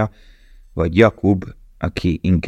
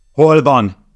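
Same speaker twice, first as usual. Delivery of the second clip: father bellows it, loud and high; roaring father; 8 semitones higher, 6 dB louder than usual. A narrator's reading. Father storming into the house, roaring. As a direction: shout it like an angry father bursting in. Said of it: "ordította az apja".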